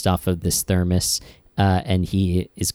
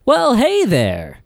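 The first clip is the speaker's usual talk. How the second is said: animatronic greeter; 9 semitones higher than usual. Pretend. Host pretending to be his animatronic relic welcoming visitors, hammed-up greeting. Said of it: "I'd be like".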